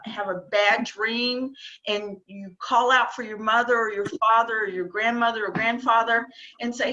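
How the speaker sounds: usual speaking voice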